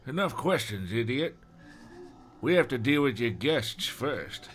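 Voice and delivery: lightly voiced dim thug; mildly oafish henchman; dumb gunman. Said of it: "like, the dumb one".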